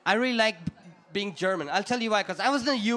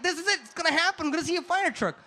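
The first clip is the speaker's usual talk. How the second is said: put-on higher-pitched childlike anticipation; excited kid; 6 semitones higher, faster than usual. Kid watching a building burn for the fire truck, excited. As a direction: imitate a kid bursting with excitement. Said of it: "I was so excited, like a kid".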